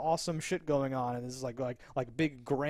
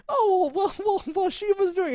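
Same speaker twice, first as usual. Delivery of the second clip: much higher, louder, sing-song mocking; 5 dB louder than usual. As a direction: ham it up, prancing high voice. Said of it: "she's totally over the".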